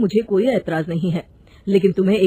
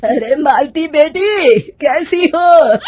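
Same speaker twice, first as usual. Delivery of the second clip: slow, much higher, loud call across the market; old man calling out to Malti in the bazaar; 7 semitones higher, 6 dB louder, much slower than usual. Acted. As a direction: hail her from a distance. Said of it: "उसे आवाज देता है".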